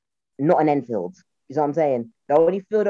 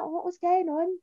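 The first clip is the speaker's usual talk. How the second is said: high, soft Brummie imitation; Brummie, pitched high and softened; Brummie accent; 14 semitones higher, 6 dB quieter, slower than usual. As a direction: mimic a baffled Brummie friend. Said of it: "she had that Brummie accent".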